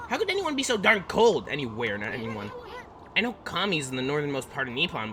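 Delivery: high, brash little companion, freezing; shivering sprite voice. Acted. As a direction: chatter like a tiny cold sidekick. Voices game character dialogue.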